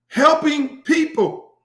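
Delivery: "Helping people" sung out high, loud and slow; high drawn-out catchphrase. Pretend. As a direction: belt the tagline, stretched out.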